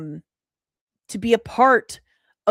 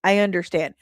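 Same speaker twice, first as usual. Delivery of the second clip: ordinary delivery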